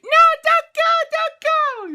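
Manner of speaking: very high, loud mimicked plea; imitated pleading cry. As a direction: cry out like the girl, hammed-up. Retold farewell scene at the portal.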